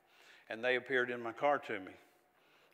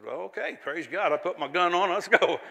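Texan bravado; 4 semitones higher, 8 dB louder than usual. Retold or imitated. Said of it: "sounds like a Texan thing to say".

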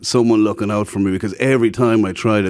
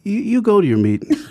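high mock argument; 10 semitones higher than usual. Retold argument with cocaine customer.

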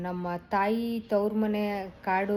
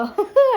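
burst of laughter; 16 dB louder than usual. Real, not acted. Laughing while retelling crying.

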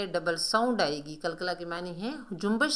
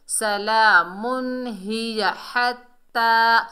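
slow, louder chant linked without a stop; joined recitation; 3 semitones higher, 5 dB louder, much slower than usual. Shown as a demonstration. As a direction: carry the words through on long vowels. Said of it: "हम मिलाकर पढ़ेंगे".

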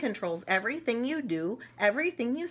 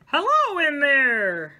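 slow, loud call, higher; 6 semitones higher, 9 dB louder, slower than usual. Acted. Book character calling into a child's head. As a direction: shout into the ears as if into a cave.

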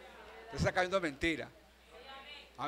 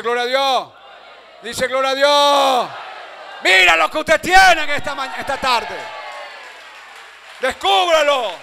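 loud, high, shouted preaching; prédica a toda voz; 8 semitones higher, 11 dB louder, slower than usual. Real, not acted. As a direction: rouse the congregation at full force. Preaches at full force. (predicación gritada, fuerte y aguda)

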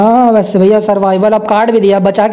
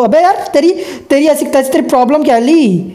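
high, annoyed scolding at a game character; exasperated yell; 6 semitones higher than usual. Real, not acted. Gamer scolding Lee in the game, exasperated high yell. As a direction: snap at Lee, pitch way up.

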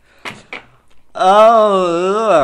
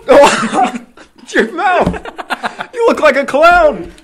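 laughing in pain; 8 semitones higher, 4 dB louder, slower than usual. Real, not acted. Burning mouth, laughing.

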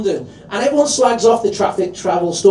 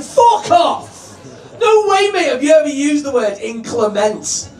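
mock outraged shout; 7 semitones higher than usual. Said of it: "You're like".

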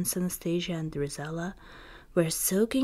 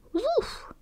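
much higher yappy woof; high dog bark. Acted. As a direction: yip it like a small dog. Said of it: "Bruno barked".

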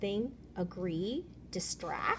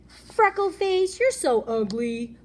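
high, loud, slow sneer; bully taunt; 7 semitones higher, 7 dB louder, slower than usual. Acted. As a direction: drag the insult out to sting.

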